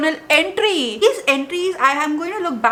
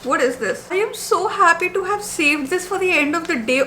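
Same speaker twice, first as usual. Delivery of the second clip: ordinary delivery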